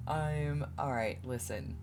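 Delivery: ordinary delivery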